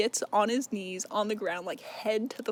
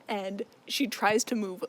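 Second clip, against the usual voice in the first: usual speaking voice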